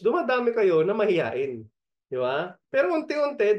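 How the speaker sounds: ordinary talk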